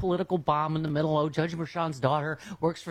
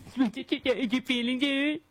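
slow, high condescending coo; mocking baby voice; 6 semitones higher, much slower than usual. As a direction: talk down like to a child. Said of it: "doing that voice that you and I do".

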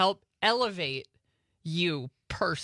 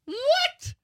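very high shocked question; surprised; 3 dB louder than usual. Real, not acted. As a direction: jump up in disbelief. Shocked he disliked it.

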